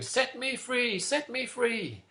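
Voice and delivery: high, drawn-out storybook cry; mock roaring shout. Trapped lion shouting to be set free, high mock roar. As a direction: call out high, without real volume. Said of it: "roaring at the top of his voice, shouting".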